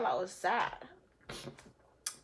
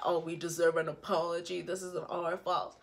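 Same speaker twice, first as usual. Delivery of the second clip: lower, softer mock whine; mocking the family; 8 semitones lower, 8 dB quieter than usual. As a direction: sneer their excuse back at them.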